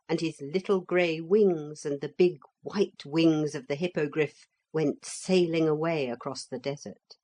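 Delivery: usual speaking voice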